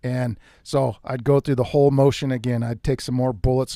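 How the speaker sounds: ordinary talk, nothing marked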